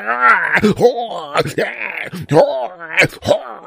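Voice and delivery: high-pitched exaggerated effort cries; straining groans. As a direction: let out hammed-up agonized groans. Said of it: "Why are you making all those noises?".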